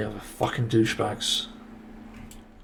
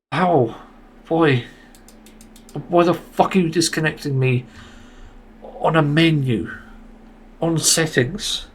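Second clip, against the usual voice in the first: angry disbelief; 3 semitones higher than usual. Real, not acted. Ranting about disconnect on menu.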